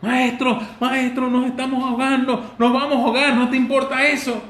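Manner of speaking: high, loud panicked plea with a laugh; desperate. Shown as a demonstration. Preacher showing how desperate disciples really cried out, high panicked plea. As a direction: show how desperate men really shout. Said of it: "Nadie habla así cuando está desesperado".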